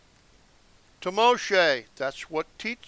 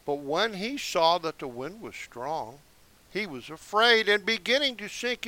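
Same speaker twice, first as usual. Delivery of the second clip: usual speaking voice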